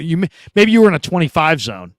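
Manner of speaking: ordinary talk, nothing marked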